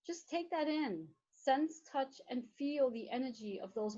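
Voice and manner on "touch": ordinary talk, nothing marked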